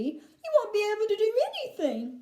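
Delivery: high-pitched wail of dismay; distressed cry. A narrator's reading. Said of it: "cried Missus T V".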